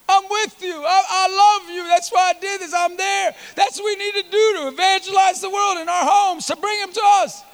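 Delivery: high, fervent sermon voice; impassioned preaching. Acted. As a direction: preach it with rising urgency, pitched high. Preaches at full force.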